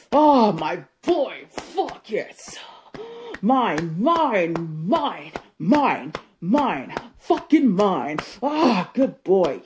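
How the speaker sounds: loud, furious claiming of him; possessive rage. Acted. Jealous wife vowing nobody takes her husband, shouting. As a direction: shout that he is hers alone.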